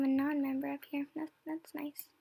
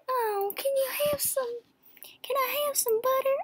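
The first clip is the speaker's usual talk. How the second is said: put-on high, whiny old farm girl voice; lame farm girl impression; 12 semitones higher than usual. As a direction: plead like a wee old farm lady. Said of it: "my lame impression".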